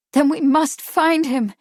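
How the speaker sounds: high, loud urgent cry. A narrator's reading. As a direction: cry out with desperate insistence. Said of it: "Kate cried".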